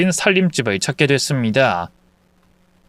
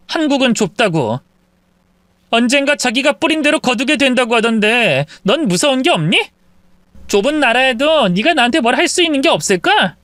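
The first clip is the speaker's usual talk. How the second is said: calm, ominous warning; warning wife; 8 semitones higher than usual. Acted. Wife reminding mistress Korea is small, ominous.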